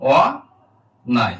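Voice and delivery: অ, a pause, then নাই; natural অ demonstration. Shown as a demonstration. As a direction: break অন্যায় into open "aw" and "nai". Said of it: "অ আর অ আর মতোই থাকবে".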